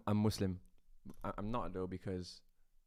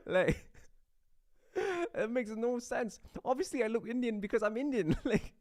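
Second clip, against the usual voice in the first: very high, squeaky with laughter; helpless laughing protest; 11 semitones higher than usual. Real, not acted. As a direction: struggle to argue while cracking up.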